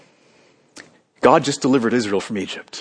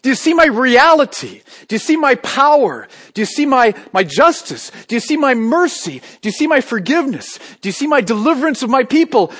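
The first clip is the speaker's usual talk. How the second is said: high, loud pleading questions; loud preacher voicing God; God's challenge; 10 semitones higher, 5 dB louder than usual. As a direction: press each question on the crowd. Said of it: "God says".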